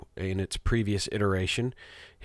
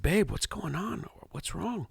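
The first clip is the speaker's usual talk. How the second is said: tender concern; 8 semitones higher, 5 dB quieter than usual. Retold or imitated. Dad comforting crying daughter.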